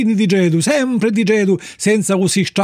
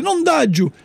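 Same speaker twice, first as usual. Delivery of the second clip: higher-pitched puppet refusal; mimed refusal; 8 semitones higher, slower than usual. A narrator's reading. Puppet signals he has no money, a high refusal. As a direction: refuse in a raised little cry. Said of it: "fece segno con la capo".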